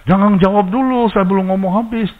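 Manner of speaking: high, forceful cut-off; stern loud rebuke. Retold or imitated. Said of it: "Harus keras loh".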